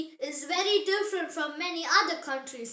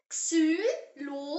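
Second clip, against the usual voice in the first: "Xylophone" slow, each syllable separate; slow model pronunciation; slower than usual. Shown as a demonstration. Teacher has learners repeat the German word, slow model pronunciation. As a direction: say "Xylophone" slowly in three detached German syllables. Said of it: "Say it".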